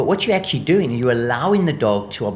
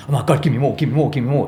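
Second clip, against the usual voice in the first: louder, eager voicing of a hyped-up dog; overexcited dog voice; 2 semitones higher, 4 dB louder, faster than usual. Trainer voicing a dog begging for more, eager and a bit louder. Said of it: "stimulating the dog back up to".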